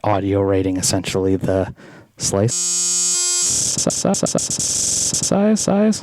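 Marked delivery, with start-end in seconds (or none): 2.2-6.0 pitched-up glitchy repeats; glitched voice. Shown as a demonstration. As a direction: let the effect chop and pitch it up. Voice through glitch effect.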